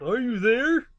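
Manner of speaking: calling out. A narrator's reading. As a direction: call out searching.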